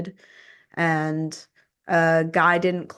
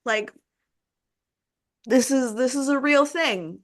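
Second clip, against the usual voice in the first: higher-pitched exasperated protest; retold frustration; 6 semitones higher than usual. Dog owner retelling her argument with animal control, frustrated. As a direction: plead to be taken seriously, pitch lifted. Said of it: "was just so frustrated".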